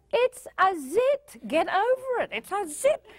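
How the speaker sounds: high, emphatic, exasperated adult; exasperated retort. Acted. Exasperated adult answering the teenager.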